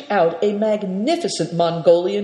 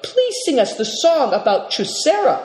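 eager plea in a higher voice; begging; 8 semitones higher than usual. A narrator's reading. Naidong asks the Marin to sing, begging. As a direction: coax him, pitched up and pleading. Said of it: "begged Naidong".

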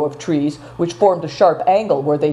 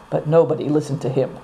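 usual speaking voice

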